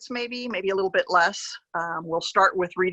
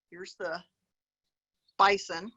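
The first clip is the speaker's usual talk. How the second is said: usual speaking voice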